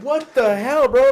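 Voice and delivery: put-on a high, loud, half-laughing "what the hell" at a kid; reliving a near crash, mock outrage; exasperated. Skater retelling a kid cutting him off, exasperated yell. Retold annoyance at swerving kid.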